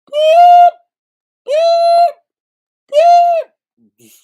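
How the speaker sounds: very high, loud mock reversing beeps; truck backup beeps. Teasing Brianna with piercing truck backup beeps. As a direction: beep like a reversing dump truck.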